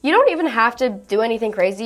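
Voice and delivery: normal talking voice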